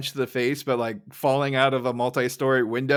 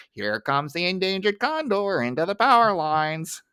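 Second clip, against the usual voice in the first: higher, put-on coaxing voice; cutesy baby-feeding talk; 5 semitones higher than usual. Smithers treating Burns like a child, cutesy sing-song coaxing. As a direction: coax him playfully like a little child. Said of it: "treats him like a child".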